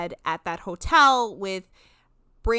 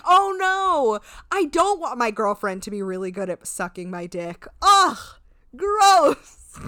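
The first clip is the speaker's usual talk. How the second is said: sarcastic mock disgust; 7 dB louder than usual.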